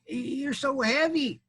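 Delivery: high, very slow complaint; put-out storybook critter; whining armadillo. Acted. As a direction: drag out the grievance in a high voice. Squashed armadillo repeating its complaint.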